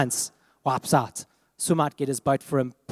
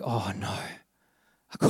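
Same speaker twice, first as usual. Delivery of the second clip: lower, much softer and slow dismay; heart sinking; 8 semitones lower, 8 dB quieter, much slower than usual. Retold or imitated. Father sees the lost rods, slow sinking quiet despair. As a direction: let the heart sink on each word. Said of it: "My heart just sank".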